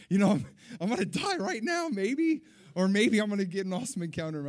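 loud, high, excitable retelling; worked-up panic. Retold or imitated. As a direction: blurt it high and loud, hammed-up alarm. Reliving panic at the altar call.

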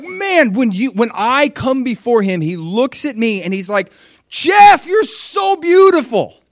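high, loud, slow adoring exclamation; gushing praise. Acted. Jesus telling Jeff he's beautiful, high and gushing. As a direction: pour out wonder at Jeff. Preacher voicing Jesus to him.

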